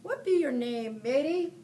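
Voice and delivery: loud pirate question; hammed-up pirate growl; captain asking the boy's name. A narrator's reading. Pirate captain asks boy.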